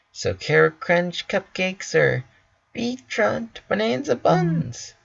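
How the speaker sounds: sing-song the silly names; Pinkie asks. Acted. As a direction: Pinkie offering cupcake choices to Rainbow. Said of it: "Pinkie asks".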